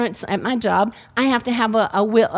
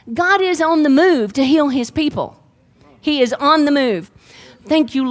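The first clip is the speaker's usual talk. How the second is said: louder, higher preaching voice; 4 semitones higher, 3 dB louder than usual. Acted. Pastor preaches to the church about healing, with rising fervour.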